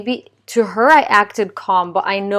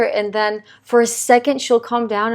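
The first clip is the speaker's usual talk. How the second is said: ordinary delivery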